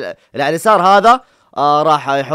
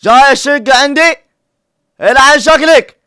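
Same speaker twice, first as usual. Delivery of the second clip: high, loud mock insult; mock angry shout; 8 semitones higher, 8 dB louder than usual. Real, not acted. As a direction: bark back the insult. Echoes insult at friend.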